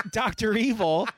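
usual speaking voice

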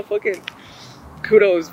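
usual speaking voice

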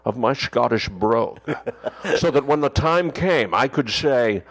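slow Scottish Connery voice, mock earnest; Connery explaining his dialect coach, half-serious; Sean Connery impression. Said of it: "that was Sean Connery".

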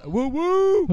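loud, high party whoop; high-pitched hollered cheer; yelling out. Retold or imitated. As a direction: whoop at full volume. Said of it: "I yell".

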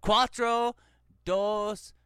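very high, loud, slow numbers; hammed-up shouting for a foreigner; loud exaggerated Spanish counting. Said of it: "I'm like, uno".

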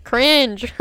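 blasting cringe into the mic; loud yell. Real, not acted. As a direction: shout the word out. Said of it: "I just blew out everyone's ears".

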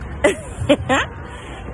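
high, breathy laugh; sheepish laugh. Real, not acted. Fan admits she took too long, sheepish laugh. Laughs at being pushed along.

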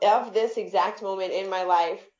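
ordinary delivery

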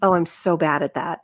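ordinary talk

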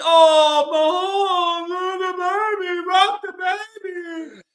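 high whiny mock praise; mocking fan voice. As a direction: gush like a doting fan. Mocking fans praising Mahomes.

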